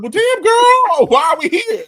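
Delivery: exasperated queen. Retold or imitated. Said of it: "You'd be like".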